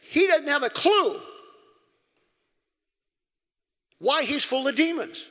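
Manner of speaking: high, loud pulpit preaching; preaching at full force. Acted. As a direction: preach it hard to the congregation.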